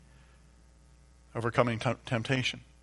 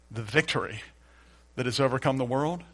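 normal talking voice

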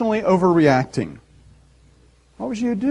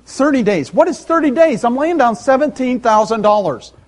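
high, loud incredulous protest; acted outrage, pitch jumping up; indignant buyer; 7 semitones higher, 4 dB louder than usual. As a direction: argue with the salesman in disbelief. Acted outraged used-car buyer.

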